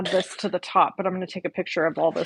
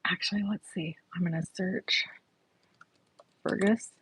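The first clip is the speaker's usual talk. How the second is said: whispering; 8 dB quieter than usual. Real, not acted. Searching quietly to herself.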